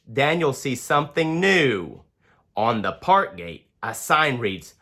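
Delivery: plain reading voice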